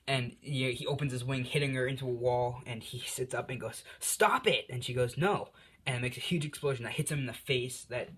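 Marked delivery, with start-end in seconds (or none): 4.0-4.7 high sharp yell to stop; shouting. Retold or imitated. He yells at her. 5.2-5.5 high, bratty refusal; defiant no. Retold or imitated. She refuses teasingly.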